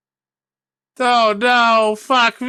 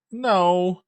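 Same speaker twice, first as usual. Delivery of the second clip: ordinary talk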